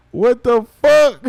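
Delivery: loud, high, slowed exclamation; can't believe it all over again; amazed shout. Real, not acted. Rewatching the site, amazed.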